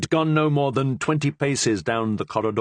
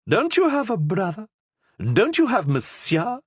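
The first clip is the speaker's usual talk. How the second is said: higher, pointed questions from the queen; queen's voice; 12 semitones higher than usual. A narrator's reading. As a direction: press him with a higher questioning voice.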